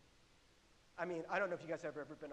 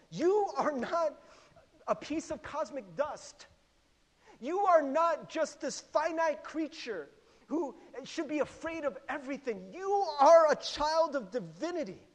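loud, raised preaching voice; emphatic proclamation; 8 semitones higher, 11 dB louder than usual. Acted. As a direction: declare it forcefully, stretching the key words. Voicing the Spirit convincing us.

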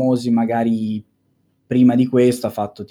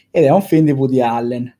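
ordinary talk, nothing marked

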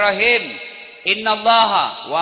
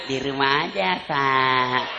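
soft, low coaxing reply; sultry wife; 4 semitones lower, 3 dB quieter, slower than usual. Acted. Wife telling husband on the phone, soft and sultry. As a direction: answer him softly, sweetly. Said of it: "Suara begini yang kasih nafsu".

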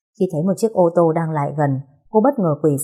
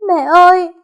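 wailing cry, much higher and louder, slow; anguished scream; 12 semitones higher, 9 dB louder, slower than usual. A narrator's reading. Nhiên seeing her mother's covered body, screaming. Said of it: "Cô gào lên".

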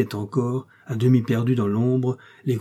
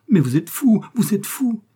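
much higher, lilting mock scolding; giddy protest; 11 semitones higher, 5 dB louder than usual. A narrator's reading. Emma to Léon as he kisses her, giddy protest. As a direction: protest high and giddily, half-amused. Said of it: "disait-elle avec de petits rires sonores".

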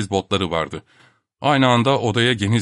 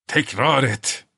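slow menacing; 3 semitones higher, much slower than usual. A narrator's reading. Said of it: "diye gürledi Bormental".

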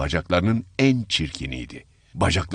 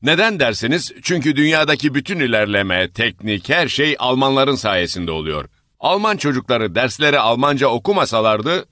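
louder, higher pompous outburst; father bragging about Germany, shouting; 6 semitones higher, 4 dB louder than usual. Acted. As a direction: jump up and shout over them. Same father keeps lecturing.

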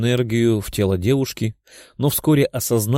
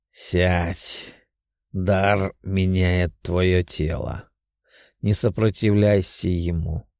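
kind voice; 4 semitones lower, much slower than usual. A narrator's reading. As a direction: gently guide her through it. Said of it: "добрым голосом сказал демон".

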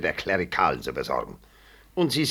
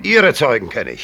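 loud scornful jab at the witnesses; heated rebuttal; angry retort; 5 semitones higher, 10 dB louder than usual. Acted. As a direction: fling it back angrily. Heated quarrel over witnesses.

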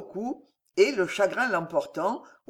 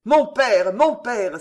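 beside himself; 3 semitones higher, 6 dB louder, slower than usual. A narrator's reading. Son crying out to his departing father, loud and distraught. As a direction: call out to a father who is leaving, overwhelmed. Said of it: "s'écria Jean hors de lui".

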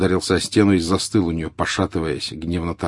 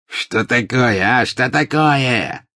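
louder, slow, furious repeated question; angry shout; 5 semitones higher, 4 dB louder, much slower than usual. A narrator's reading. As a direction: snap back in sudden rage. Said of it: "закричал обозлившийся вдруг". (злобный крик, громче и медленнее)

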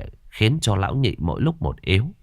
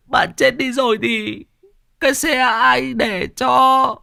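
comic whimpering. Acted. Thọt blubbers at his dying friend's side, high whimpering. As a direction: blubber pleading with your friend not to die. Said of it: "lại mếu máo".

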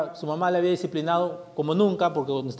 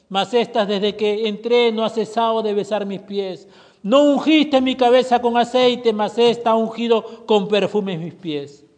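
raised, forceful reading of the rebuke; preaching scripture; 2 semitones higher, 6 dB louder than usual. Acted. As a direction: proclaim it with rising fervor. Preacher reads Jesus' rebuke. (lectura bíblica enfática y fuerte)

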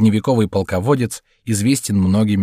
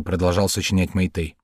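plain reading voice

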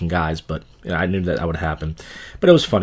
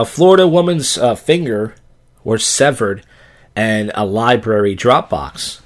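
odd news read; 3 semitones higher, 5 dB louder, slower than usual. Acted. Odd news segment headline read.

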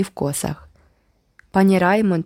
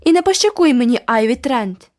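furious cry; 6 semitones higher, 5 dB louder than usual. A narrator's reading. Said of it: "люто скрикнув Джеральд".

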